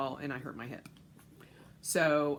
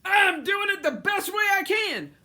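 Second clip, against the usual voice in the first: mocking gym-goer; 10 dB louder than usual. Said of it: "you're thinking".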